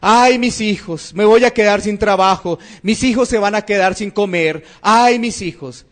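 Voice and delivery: ordinary talk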